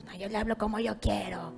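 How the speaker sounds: soft, low whispered back-talk; hushed, sulky retort; defiant muttering. Acted. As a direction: grumble defiance under your breath.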